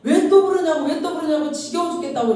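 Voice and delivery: high-pitched fed-up outburst; acted exasperation. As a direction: snap back as if sick of being called. Retold fed-up reply to being called.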